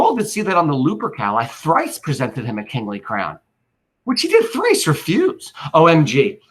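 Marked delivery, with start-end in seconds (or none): 4.0-5.5 much higher, strained, theatrical line; dramatic high. Shown as a demonstration. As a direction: lift the voice way up for the refusal. Dramatic recitation of Antony.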